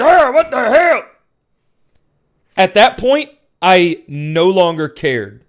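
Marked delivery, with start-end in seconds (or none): 0.0-1.0 high, startled revival yelp; mock gasp back to life, pitched way up; Barret. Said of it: "Barret springs to life".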